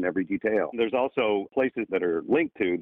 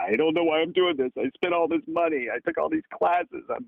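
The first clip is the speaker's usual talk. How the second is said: much higher, strained despairing voice; imitating a distraught student; 8 semitones higher than usual. Student breaks down to the acting coach, high and despairing. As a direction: plead as if at the end of your rope. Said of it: "break down in my studio".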